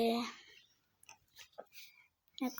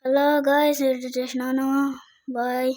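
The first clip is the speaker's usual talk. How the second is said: loud announcement; 8 dB louder than usual. Real, not acted. Player addresses viewers after a kill, loud announcement. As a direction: project it boisterously to the audience.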